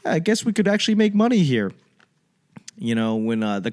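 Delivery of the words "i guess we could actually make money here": mock Boston realization. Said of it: "was like".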